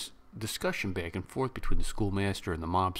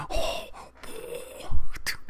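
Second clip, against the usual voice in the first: normal talking voice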